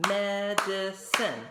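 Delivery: "Medicine" slow and loud, med-i-cine; "Medicine" chanted in claps; clapped syllables. Shown as a demonstration. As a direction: beat out "Medicine" syllable by syllable, loudly. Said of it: "Three claps".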